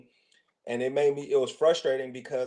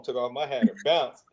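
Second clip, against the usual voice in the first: ordinary delivery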